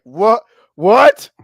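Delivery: high, loud, rising disbelief, growing stronger; mock astonishment. Imitating friend handed free games, astonished. Retells friend's shock at gift.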